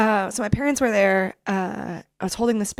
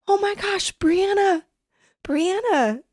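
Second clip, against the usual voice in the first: high, emotional calling of a name; overjoyed cry; 10 semitones higher, slower than usual. Retold or imitated. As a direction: call her name high with joy. Mom overjoyed seeing daughter.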